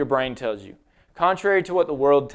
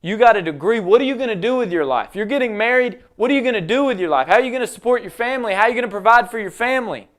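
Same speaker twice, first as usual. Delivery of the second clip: mock-worried doubter, higher and faster; the world questioning; 6 semitones higher, 3 dB louder, faster than usual. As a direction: needle him like a nagging world. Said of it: "the world looks at me and is like".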